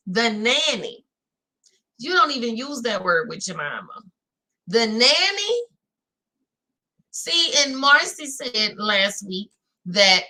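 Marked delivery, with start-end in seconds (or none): 4.7-5.6 incredulous. Real, not acted. Repeating a shocking word.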